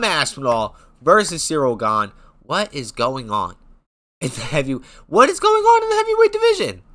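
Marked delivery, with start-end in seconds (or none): 5.1-6.8 high-pitched loud incredulous shout; squealed outrage at the matchmaking, much higher and louder; exasperated outcry. Acted. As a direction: throw the question up in total disbelief. Exasperated outburst about matchmaking.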